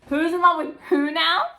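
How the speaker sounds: loud, high butting in; surprised newcomer. Retold or imitated. Elijah barging in on the brothers, loud and high. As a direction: cut in with nosy surprise. New voice pops up.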